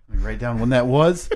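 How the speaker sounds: ordinary talk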